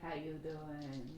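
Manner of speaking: put-on low, slow, greasy drunk come-on; soft lazy flirty drawl. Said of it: "And he goes".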